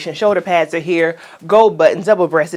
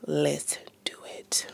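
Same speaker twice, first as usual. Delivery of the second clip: whisper; 3 semitones lower, 16 dB quieter than usual. Real, not acted. Whispered aside to camera.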